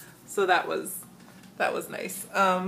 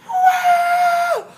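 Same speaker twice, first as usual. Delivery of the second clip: high, loud long shrieks; drawn-out mock crowd scream; screaming audience; 7 dB louder than usual. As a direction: imitate fans going wild. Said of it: "for the audience to stop screaming".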